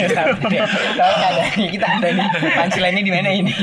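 loud and laughing through the words; chuckling as he teases the others; laughing while talking. Real, not acted. Teasing friends about the missing punchline, laughing loudly.

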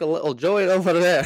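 botched Australian accent. Shown as a demonstration. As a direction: overdo an Australian accent and slip towards Irish. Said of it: "In Ireland, apparently".

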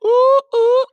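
high falsetto ooh ooh squeal; delighted falsetto hoots. Acted. Squeal after mock quote.

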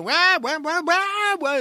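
high squawking wahs, mock hen screams; mock chicken squawks; chickens screaming. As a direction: squawk it like a yard of frantic hens.

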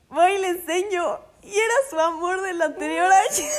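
high, loud, laughing through the words; can't get it out for laughing; real laughter at the twist. Real, not acted.

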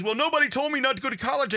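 high, fast whiny son voice; mocking son. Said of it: "he goes".

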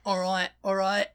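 a light regional "All right, all right", vowels stretched; "all right" accent. Retold or imitated. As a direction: toss off "all right" in a hint of a local accent.

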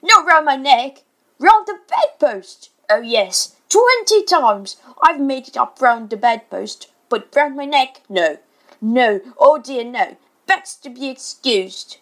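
loud, mocking tirade with high swoops; exasperated father in biting mock politeness; dangerously sarcastic. A narrator's reading. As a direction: pour on the sarcasm, punching the key words. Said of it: "He became dangerously sarcastic".

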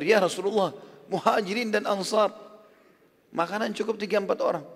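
high-pitched, acted dismay; startled protest. Startled Jabir questions the Prophet about the food, acted startled protest. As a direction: protest in mild mock alarm, voice lifted. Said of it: "Kaget di depannya Rasulullah".

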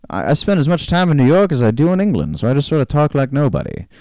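lightly put-on transatlantic accent; a hint of a posh transatlantic actor; mild mock transatlantic accent. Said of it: "called a transatlantic accent".

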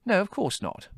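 high-pitched, irritated retort; irritable. A narrator's reading. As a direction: answer testily, voice pitched right up. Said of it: "said Margot irritably".

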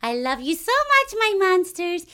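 high, slow, doting cartoon mom; monster mama voice. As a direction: coo like a sweet dingy monster mom. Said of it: "the mama who's like".

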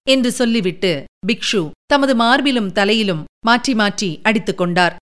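ordinary delivery

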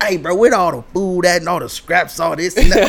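loud, high, laughing ad-lib of a friend's line. Retold or imitated. Friend hamming up a scripted line, loud and laughing. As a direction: belt out the improvised line with a laugh. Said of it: "he was like".

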